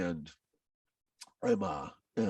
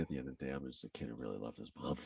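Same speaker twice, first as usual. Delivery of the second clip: hushed whisper; 8 semitones lower, 14 dB quieter, faster than usual. Real, not acted. Emotional close, earlier choked up.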